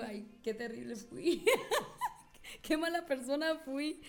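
laughing through the words; giggling self-reproach; mock-guilty, laughing; laughing at herself. Real, not acted.

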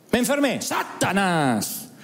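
slow drawn-out mock cry of Satanás; mock blame cry. Preacher mocking believers who blame Satanás for everything. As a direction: drag out Satanás slowly like a fearful believer.

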